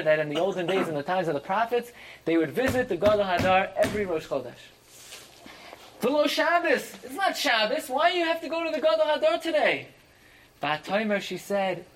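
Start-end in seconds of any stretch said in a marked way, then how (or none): 6.0-9.9 puzzled husband. Acted. Husband questioning his wife, higher, faster.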